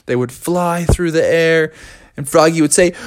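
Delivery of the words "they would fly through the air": ordinary delivery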